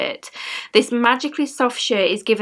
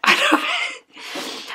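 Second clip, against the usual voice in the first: tearful; much slower than usual. Real, not acted. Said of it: "it's really made me sad".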